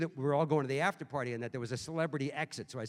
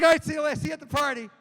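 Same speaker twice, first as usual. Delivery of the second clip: loud, high, starstruck yell; giddy shout; 13 semitones higher, 13 dB louder, faster than usual. Retold or imitated. Starstruck fan yelling goodbye to [name], giddy loud. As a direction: call after her, overexcited.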